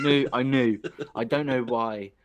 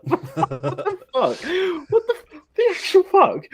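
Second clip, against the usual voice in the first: high, loud, squealing through laughter; helpless laughter; 6 dB louder, slower than usual. Real, not acted. Host cracking up at the guest's outrageous remark, helpless laughter. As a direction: barely get the words out for laughing.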